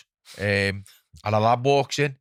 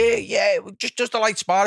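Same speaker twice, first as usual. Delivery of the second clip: mock innocent lad; 8 semitones higher, 3 dB louder than usual. Imitating the lad backing down.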